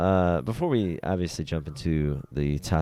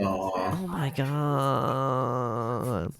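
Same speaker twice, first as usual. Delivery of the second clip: touched sigh; 5 semitones higher, 7 dB quieter, much slower than usual. Retold or imitated. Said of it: "And I'm like".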